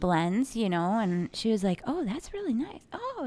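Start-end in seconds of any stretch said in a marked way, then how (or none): 1.9-3.2 high, soft imitated cooing; excited customer. Imitating the customer shown winter blends, excited. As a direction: act out delight in a small high voice. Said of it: "she was very excited".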